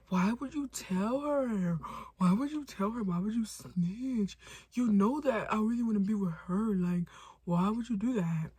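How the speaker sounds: slow, drawn-out mock pleading; whining boyfriend. Caught boyfriend pleading with her for snitching, whining. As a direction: stretch each question into a whine.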